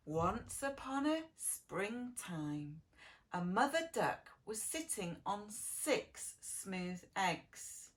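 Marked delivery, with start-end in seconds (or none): none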